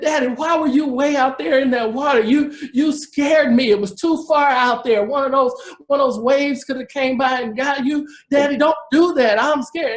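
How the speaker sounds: high, pleading, frightened; imitating a frightened daughter scolding her dad; scared mom. Daughter begging her father not to swim so far, scared and high. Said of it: "She said, ... I'm scared".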